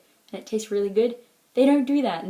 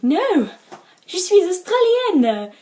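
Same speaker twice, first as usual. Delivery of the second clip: high, loud, drawn-out retort; hammed-up proud declaration in French; emphatic announcement; 9 semitones higher, 8 dB louder, slower than usual. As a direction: proclaim it loudly, stretching the words. Acts out proud reply to foreigners.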